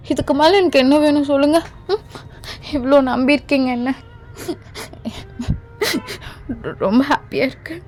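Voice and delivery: tearful thanks. Acted.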